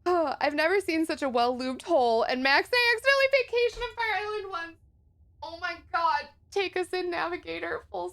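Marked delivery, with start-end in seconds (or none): none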